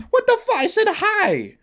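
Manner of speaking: shrill incredulous protest; indignant disbelief. Real, not acted. Complaining about Tinder replies, incredulous high squeak. Outraged at dating app reply.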